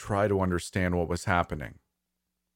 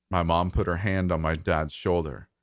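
ordinary delivery

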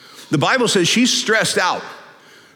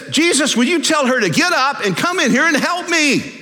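high-pitched indignant complaint; exasperated Martha; 8 semitones higher than usual. Acted. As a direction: plead for backup against a lazy sister. Acts Martha complaining to Jesus.

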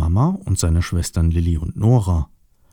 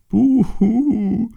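high hooting boo-hoo wail; mock crying. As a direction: wail a long, high pretend sob. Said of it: "weinte der Busch".

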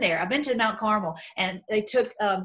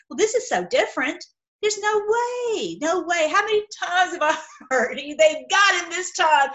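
mock disbelief; 7 semitones higher than usual. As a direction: scoff like a sceptic who has heard it all before. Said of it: "You're like".

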